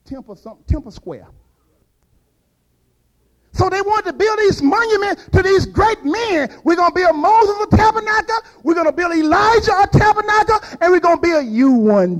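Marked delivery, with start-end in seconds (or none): 3.5-12.2 high, loud preacher's build-up; full-force preaching. Acted. Preacher rousing the congregation, loud and high. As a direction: climb up hard as the sermon takes off.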